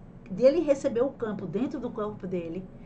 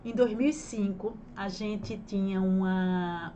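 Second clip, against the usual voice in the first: usual speaking voice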